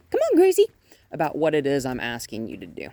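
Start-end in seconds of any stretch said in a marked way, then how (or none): 0.1-0.7 very high, loud call to the dog. Real, not acted. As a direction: sing out her name brightly. Trainer calling dog.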